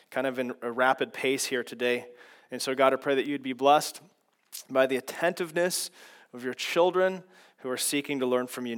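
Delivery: low, hushed prayer voice, well below the teaching voice. Acted. Pastor praying over the class before the lesson, low and reverent.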